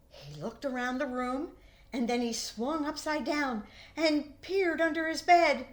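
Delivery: ordinary delivery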